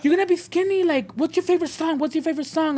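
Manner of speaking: high, singsong distraction; coaxing staff imitation. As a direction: mimic a nurse cheerily distracting a patient. Imitates staff distracting nervous patient.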